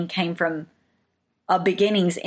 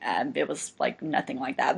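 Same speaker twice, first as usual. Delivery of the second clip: lower, softer mock brush-off; imitated dismissive mom; 5 semitones lower, 6 dB quieter than usual. Mom setting her child's memory straight, mildly dismissive. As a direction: lightly voice a mom downplaying it. Voices mom downplaying the story.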